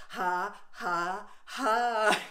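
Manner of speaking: staged ha ha ha tipping into real laughs; fake laughing into real laughter. Shown as a demonstration. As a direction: laugh on cue and let it run free. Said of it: "boom shaka laka laughter".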